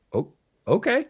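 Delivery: high-pitched, rising little reply; pleasantly taken aback. Retold or imitated. Analyst retelling being invited to the table, surprised.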